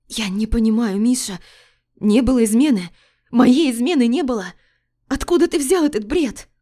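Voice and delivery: wife denying to her husband in panic; distressed protest. Acted. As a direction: plead in disbelief, pitch climbing.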